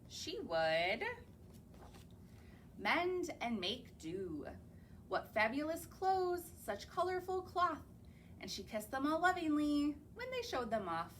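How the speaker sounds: usual speaking voice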